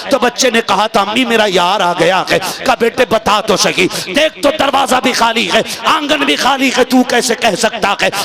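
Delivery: loud, high, impassioned climax; preaching at full force. Acted. Preacher telling the boy's last moments at full force. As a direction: drive the story to its peak, loud and high.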